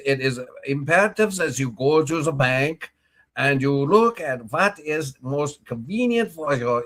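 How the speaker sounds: mock German accent, slow and drawn out; imitating a German treasurer in a mock German accent. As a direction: put on a hammed-up German accent. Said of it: "practice my German accent".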